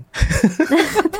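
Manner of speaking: quick amused laugh; a brief laugh at the joke; short laugh. Real, not acted. Reacting to punchline.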